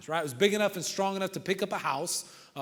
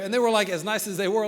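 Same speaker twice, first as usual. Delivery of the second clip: usual speaking voice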